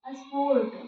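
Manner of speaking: स्पोर्ट with the r sounded before the t; teacher models स्पोर्ट, r clearly audible; r pronounced. Shown as a demonstration. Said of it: "इसमें आर का उच्चारण आ जा रहा है".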